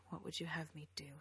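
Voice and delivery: tightly held-back soft question; suppressed feeling, hushed; restrained, low voice. A narrator's reading. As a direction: keep it low through gritted teeth. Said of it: "summoning every bit of his restraint".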